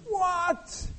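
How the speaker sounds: very high, loud disbelieving cry; shocked outrage. Retold or imitated. Preacher acts out shock at the church heating bill. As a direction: squeal at the bill.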